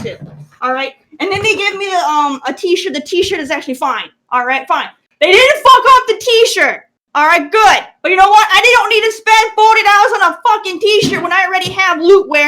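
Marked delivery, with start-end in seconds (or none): 5.2-12.5 loud rant. Real, not acted. Ranting at Loot Crate box.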